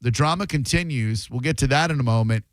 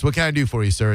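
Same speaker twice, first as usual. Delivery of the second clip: ordinary talk, nothing marked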